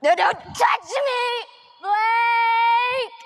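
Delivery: loud, high, pushing helpers away; panicked mother shouting; frantic shout. Acted. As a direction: shove them off and scream for him. Pushing past rescuers, calling her son.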